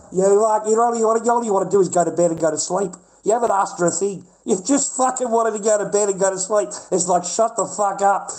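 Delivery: loud, high furious tirade; angry rant. Acted. Ranting at the boyfriend who ignores her, loud and furious. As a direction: tear into the lazy boyfriend.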